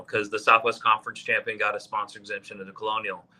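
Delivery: ordinary talk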